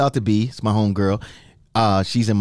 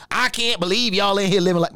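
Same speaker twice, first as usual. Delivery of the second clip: mocking judgmental partygoer; 8 semitones higher than usual. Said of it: "I'm walking around and talking about".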